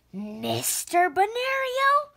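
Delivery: high, drawn-out shocked question; shocked disbelief. A narrator's reading. Girl stunned to hear her new teacher's name, shocked. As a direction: say the name as if it cannot be true.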